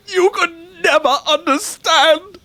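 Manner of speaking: tearful retort. Acted. Emotional quarrel, voice breaks.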